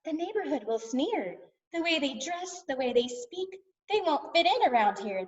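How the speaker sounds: high, softish fussy complaint; disapproving parents. A narrator's reading. Mom and Dad unimpressed by pirate neighbors, disapproving. Parents in story are not impressed.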